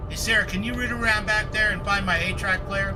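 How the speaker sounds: calling out. Real, not acted.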